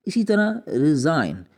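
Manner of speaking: ordinary delivery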